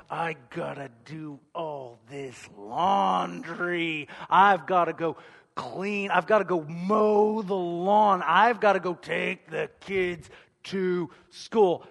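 slow, put-upon listing of chores; begrudging. Retold or imitated. Husband reluctantly reciting his chores to himself, begrudging. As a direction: drag out each chore like a burden. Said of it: "begrudgingly am like".

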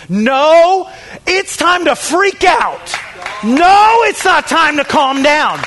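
high, full-force preaching yell; impassioned shout. Real, not acted. Preacher rejecting calls to calm down, impassioned shout. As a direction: shout it as an alarm, climbing to the last word. Preacher explodes against soft excuses.

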